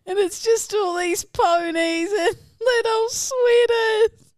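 high, loud squeal of delight; drawn-out cooing over something adorable; gushing. Real, not acted. Co-host gushes over ponies in sweaters, squealing delight.

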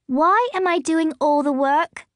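put-on a girl fed up with doing everything; angry complaint. A narrator's reading.

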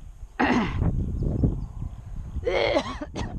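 long rough coughing; coughing fit. Real, not acted. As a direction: cough to clear a hair. Coughing on hair.

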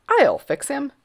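put-on high, loud boyish boast; cocky little boy. A narrator's reading. Grand Duke promising to deal with Bartholomew, cocky. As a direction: boast it like a proud child.

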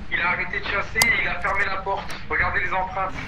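soft relieved whisper; whispering while hiding. Real, not acted. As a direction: stay hushed while the danger fades. Hunt ending, still hiding.

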